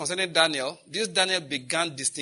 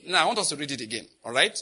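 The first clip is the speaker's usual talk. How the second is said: ordinary talk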